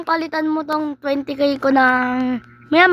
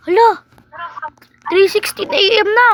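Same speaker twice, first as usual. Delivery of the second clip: startled gasp at the time; surprised; 5 semitones higher than usual. Acted. Realizes it's late at night.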